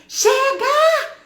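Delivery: high, loud, drawn-out yell; full-voiced shout. Mother casting out the enemy in the car, shouting. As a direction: yell it as a forceful command. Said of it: "eu gritei tanto".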